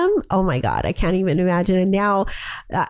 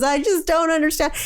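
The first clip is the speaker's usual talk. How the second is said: upset daughter; 9 semitones higher than usual. Retold or imitated. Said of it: "She goes".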